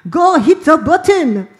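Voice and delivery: high, loud, slow barked order; cartoon Frenchman shouting. Cartoon Frenchman on a ship ordering the bomb, shouting high. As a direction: bellow the command like a cartoon captain. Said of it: "this little guy with a beret and a baguette and goes".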